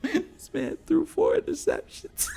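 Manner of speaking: high-pitched shout breaking into laughter; laughing shout. Real, not acted. Mocking the rival quarterback, shouting through laughter. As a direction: crack up mid-sentence.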